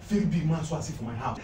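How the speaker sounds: normal talking voice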